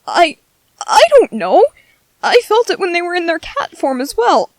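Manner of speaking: embarrassed and unsure, voice jumping up; flustered. Acted. Flustered, defensive about his feelings.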